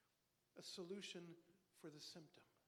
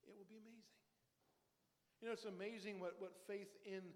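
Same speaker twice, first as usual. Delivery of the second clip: normal talking voice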